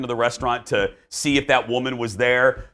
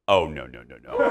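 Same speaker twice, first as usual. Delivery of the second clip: mock lowered no. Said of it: "I go".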